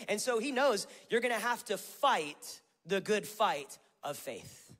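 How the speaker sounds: high, loud, driving sermon delivery; full-force preaching. Acted. Pastor firing up his church, preaching at full force. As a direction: preach it with fire, building to a shout.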